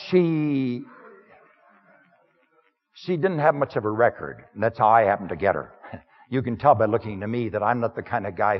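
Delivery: lightly voiced humble outsider, plain-spoken; first-person sermon character. Preacher speaking to the congregation as the Samaritan woman's man, lightly voiced. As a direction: step into the role of the woman's man, understated.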